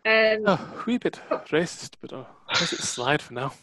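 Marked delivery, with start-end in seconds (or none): 0.1-1.9 slow, higher, mock-affronted; a hint of indignant keeper; mock offence. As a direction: play being offended, slowly. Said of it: "sorry about the accent".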